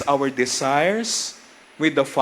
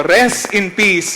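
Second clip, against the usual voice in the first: "Rest in peace" again, higher, louder and slow; insistent repeat; 4 semitones higher, 6 dB louder, slower than usual. Shown as a demonstration. Priest repeating it when the crowd won't answer, louder and insistent. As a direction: push it again, more forcefully. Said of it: "Uulitin ko".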